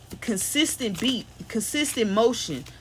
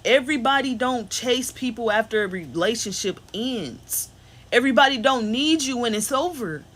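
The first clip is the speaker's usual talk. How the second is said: normal talking voice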